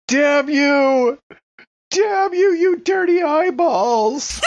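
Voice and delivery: screaming at the sky, hammed up; over-the-top mock despair yell; Heston moment. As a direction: bellow it to the heavens like a doomed movie hero. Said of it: "Having a Heston moment".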